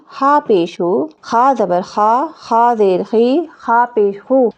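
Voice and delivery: slow, measured syllable chant, each closing vowel stretched, as in ता, ती, तू; clear articulation drill. Shown as a demonstration. Teacher shows learners clean pronunciation, slow articulation drill. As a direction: recite "ता ज़बर ता" slowly and cleanly, holding the last syllable. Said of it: "बिल्कुल सफाई सी आ रही है".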